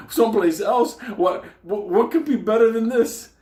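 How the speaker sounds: squeaky sarcastic yell; sarcastic disbelief. Retold or imitated. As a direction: throw his plan back at him in disbelief. Sarcastic outraged reply.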